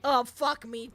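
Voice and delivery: ordinary talk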